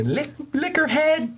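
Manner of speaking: very high, rapid squeaky put-on voice; Mara's voice. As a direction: voice Mara in a tiny high pitch. Voicing Mara, expected to attack.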